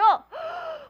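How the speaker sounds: sharp high gasp of shock. Retold or imitated. The people hearing the report, gasping high in shock. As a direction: gasp as if hit by bad news.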